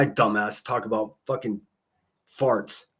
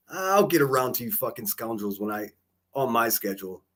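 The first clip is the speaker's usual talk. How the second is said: normal talking voice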